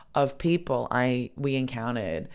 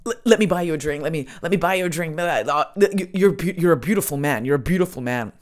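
put-on higher, fast, rambling old Scotsman; 4 semitones higher, 5 dB louder, faster than usual. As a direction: slur out a rough Scottish accent, repeating yourself eagerly. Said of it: "this old Scotsman is just going".

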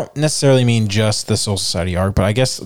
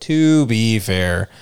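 slow, stretched-out echo; drawn-out; much slower than usual. Pretend. Conceding a point to a friend, drawn-out. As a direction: stretch each word out playfully. Playful stretched repeat of the phrase.

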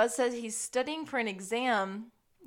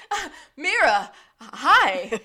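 loud, laughing, lovestruck blurt; mock-starstruck hello, much louder; flustered greeting; 5 semitones higher, 12 dB louder, much slower than usual. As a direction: blurt it loudly, tongue-tied before an old flame. Acting out flustered Gus greeting Mira.

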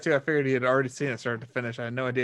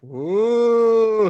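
higher, louder startled ooh as the car drifts; a jolted little ooh; startled ooh at the wheel; mock startled ooh; 7 semitones higher, 8 dB louder than usual. Mimed swerving driver.

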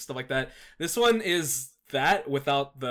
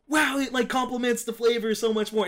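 high, excited imitated gush over the flavor; amazed delight; 9 semitones higher, 3 dB louder than usual. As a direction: mimic a wowed taster, pitched way up. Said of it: "and he's like".

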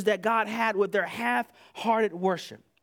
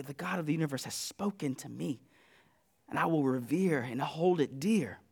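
much softer, lower reverent voice; hushed reverence; 9 semitones lower, 9 dB quieter than usual. Acted. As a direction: speak it like a quiet vow. Quoting a grateful believer.

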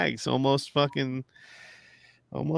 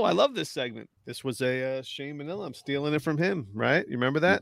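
ordinary talk

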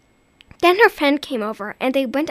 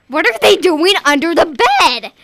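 louder, exasperated question; scolding; 4 semitones higher, 9 dB louder than usual. Acted. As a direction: scold the child. Mother scolding daughter.

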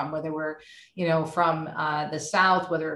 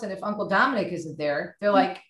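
ordinary talk, nothing marked

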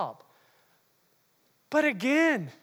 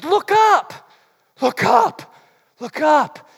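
higher, louder urgent call; calling look up; 6 semitones higher, 7 dB louder, much slower than usual. Retold or imitated. Apostle urging people to look to heaven, loud call. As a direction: lift them with the call. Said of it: "what do the apostolic do? It says".

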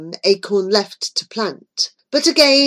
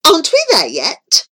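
louder, higher, fed up with the walk; impatient demand; 7 semitones higher, 5 dB louder than usual. A narrator's reading. Queen tired of the dark forest, impatient. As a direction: push to be done with this. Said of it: "she demanded impatiently".

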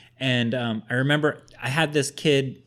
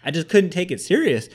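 normal talking voice

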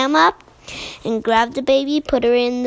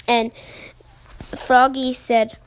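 ordinary delivery, nothing marked

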